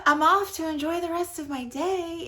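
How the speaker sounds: upbeat sign-off. Real, not acted. Signing off brightly.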